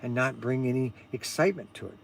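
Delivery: quiet, low bland monotone. Retold or imitated. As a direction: talk with no excitement at all. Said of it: "just kinda talks like this".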